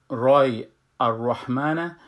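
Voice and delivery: الرحمن stretched slowly with a heavy ra; full ra. Shown as a demonstration. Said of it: "را میخوانم".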